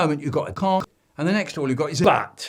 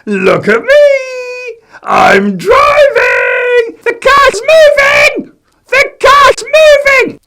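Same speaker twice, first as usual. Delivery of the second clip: very high slow shouted squeal; excited shout; 18 dB louder, much slower than usual. Acted. Man shouting to kids from the car, high squeal. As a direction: shriek with glee. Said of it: "shouted out at us".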